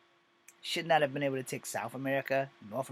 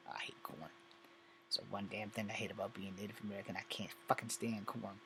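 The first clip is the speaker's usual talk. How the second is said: low, much softer grumbling about corn; muttered aside; 5 semitones lower, 11 dB quieter than usual. Real, not acted. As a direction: mutter it under your breath, disgusted.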